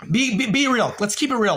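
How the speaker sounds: pitched far up, stammering demand; heated challenge. Real, not acted. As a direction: jump high and push hard, exasperated. Confronting a viewer in chat.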